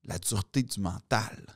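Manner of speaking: low, soft, weighty pronouncement; hushed, low acted pep-talk punchline; grand speech. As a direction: drop right down and deliver it with mock solemnity. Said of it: "t'as le grand discours".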